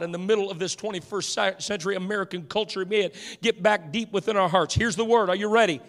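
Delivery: ordinary talk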